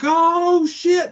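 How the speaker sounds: high, loud panicked yell; hammed-up high shriek of shock; freaking out. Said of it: "the guy goes nuts".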